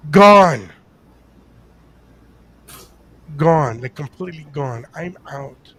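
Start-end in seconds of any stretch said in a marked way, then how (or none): 0.1-3.8 emphatic declaration. Retold or imitated. Passed out from exhaustion.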